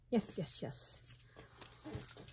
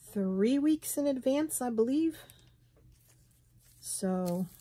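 ordinary delivery

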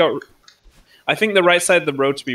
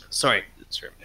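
normal talking voice

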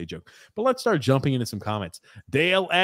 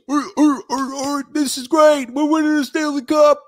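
high, loud barking seal voice; mock celebrating fan, much higher and louder; 11 semitones higher, 5 dB louder than usual. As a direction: bark it out like a clapping seal. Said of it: "like a seal".

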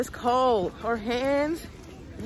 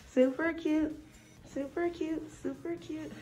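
softer and softer each time; fading repeat; 3 semitones higher, 10 dB quieter than usual. Real, not acted. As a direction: let it trail off to nothing.

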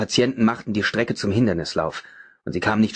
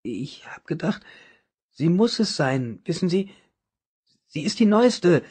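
doubt and sorrow; 8 semitones higher than usual. A narrator's reading. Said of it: "In seiner Stimme klang Zweifel und Trauer".

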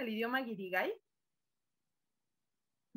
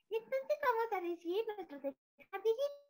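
little squirrel voice; 9 semitones higher than usual. Shown as a demonstration. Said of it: "somos unas pequeñas ardillitas".

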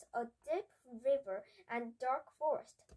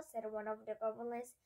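ordinary delivery, nothing marked

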